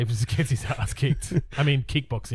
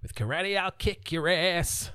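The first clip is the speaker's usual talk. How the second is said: higher, slow mock tough guy; hammed-up action hero threat; tough kid hero; 6 semitones higher, slower than usual. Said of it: "you know".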